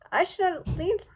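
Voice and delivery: usual speaking voice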